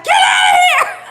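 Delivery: very high, loud shout; retold scream. Husband screaming at the fox, high loud shout. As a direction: yell it to scare the animal off. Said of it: "started screaming loud too".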